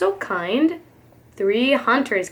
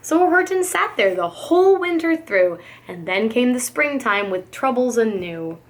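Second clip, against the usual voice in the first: usual speaking voice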